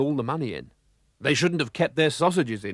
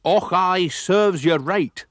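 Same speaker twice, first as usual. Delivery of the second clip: slow, loud snarl in a put-on voice, a little higher; 6 semitones higher, 7 dB louder, much slower than usual. A narrator's reading. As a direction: spit it out slowly at him. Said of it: "snarled the lorry driver".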